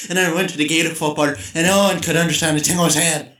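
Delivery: loud, rambling Irish brogue; strong Irish accent. Shown as a demonstration. Podcaster demonstrating an incomprehensible dialect, strong Irish accent. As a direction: put on a thick, barely intelligible Irish accent. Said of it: "really strong Irish or accents".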